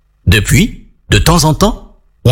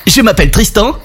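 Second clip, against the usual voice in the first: put-on higher-pitched boy hero's voice; boy introducing himself; 9 semitones higher than usual. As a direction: introduce yourself and your friend, pitched up. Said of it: "ils firent les présentations". (voix de garçon plus aiguë, qui se présente)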